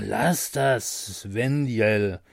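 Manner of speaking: a hint of a slurring drunk angel; drunk protest. Drunk angel fending off his friend, lightly slurred. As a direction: push him off, a touch tipsy. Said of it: "wehrte sich Karamell".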